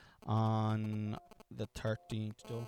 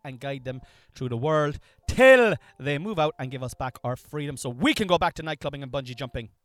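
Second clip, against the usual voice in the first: louder, rising and falling sing-song read; undulating delivery; 2 semitones higher, 9 dB louder than usual. Shown as a demonstration. Husband showing wife his undulating host style, louder. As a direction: let the line swoop up and down. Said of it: "mine will be more undulating".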